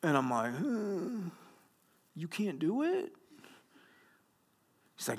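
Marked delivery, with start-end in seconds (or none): 0.5-3.1 high, hushed question climbing at the end; stunned disbelief. Retold or imitated. Pastor hearing groom back out, quietly stunned disbelief. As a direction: replay your dumbfounded reaction, softly squeaking upward. Replays reaction to called-off wedding.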